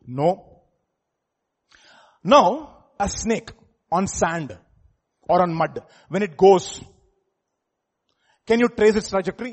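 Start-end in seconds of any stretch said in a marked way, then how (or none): 2.3-9.5 fiery loud preaching, high and loud. Acted. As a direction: thunder it from the pulpit. Preaching at full force.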